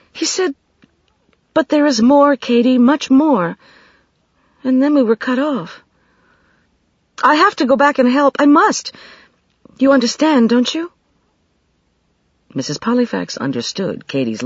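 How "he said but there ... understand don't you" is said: high, louder urgent plea; pleading young woman. A narrator's reading. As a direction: beg them to understand. Katie pleads.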